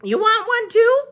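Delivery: put-on high, far-off old woman voice; Gloria Dump voice. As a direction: pitch her up as an old lady.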